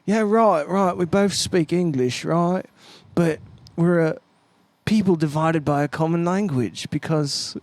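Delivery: put-on British accent, drawn out; imitated British driver. Musician imitating the tour driver on language, mock British accent. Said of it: "Our driver's like".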